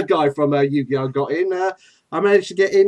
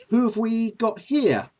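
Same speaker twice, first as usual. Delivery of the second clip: usual speaking voice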